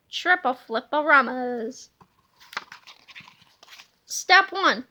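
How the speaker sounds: usual speaking voice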